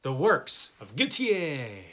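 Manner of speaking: slow, hammed-up French on "Gautier"; a playful stab at a French accent on "Gautier"; mock-French "Gautier", drawn out. Shown as a demonstration. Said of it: "my French accent isn't so good".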